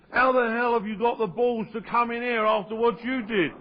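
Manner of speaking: high-pitched indignant scolding; retold outrage. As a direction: act out staff appalled at a returning customer. Voices outraged restaurant staff.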